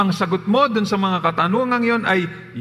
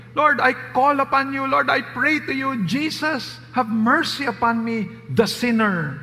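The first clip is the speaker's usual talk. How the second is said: fervent prayer; 6 semitones higher than usual. Shown as a demonstration. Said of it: "Everyone who calls on the name".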